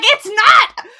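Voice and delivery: high, loud indignant insistence; hammed-up indignant yell; defensive shout. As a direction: deny it too hard, cornered.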